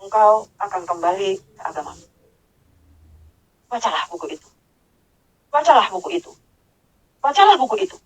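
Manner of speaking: measured recital with long pauses; deliberate, formally phrased command; scripture translation style. Retold or imitated. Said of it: "Bahasanya bagus sekali. Nah, itu lebih jelas".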